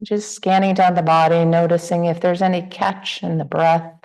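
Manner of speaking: unhurried meditation guidance with long pauses; guided meditation. Real, not acted. Teacher guiding the group to relax into the body, guided meditation. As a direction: ease listeners gently through a body scan, leaving space between phrases.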